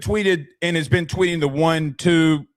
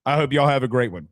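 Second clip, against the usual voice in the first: ordinary talk, nothing marked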